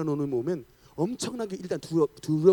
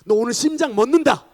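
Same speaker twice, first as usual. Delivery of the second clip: demon's threat; 5 semitones higher, 9 dB louder than usual. Retold or imitated. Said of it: "갑자기 귀신이 와가지고".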